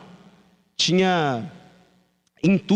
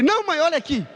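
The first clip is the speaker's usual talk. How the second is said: put-on pleading child; 10 semitones higher than usual. Hurt boy pleading with mother.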